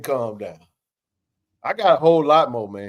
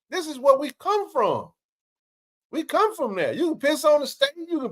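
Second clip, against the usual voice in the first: passionate; 8 semitones higher than usual. Real, not acted. Passionate rant about Jackson.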